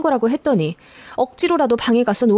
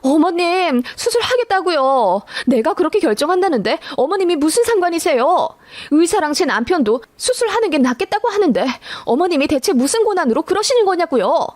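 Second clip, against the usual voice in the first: retold scream, pitched up and raised; screaming at mother-in-law; 6 semitones higher than usual. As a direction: yell back at her, at the end of your rope. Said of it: "제가 소리를 지르며".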